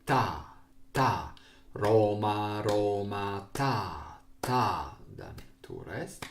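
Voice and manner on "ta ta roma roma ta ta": measured chant of syllables in strict time; evenly spaced beats, drawn-out even syllables; rhythm reading. Shown as a demonstration. Teacher showing the class the tempo, chanted rhythm reading. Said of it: "and now I will read".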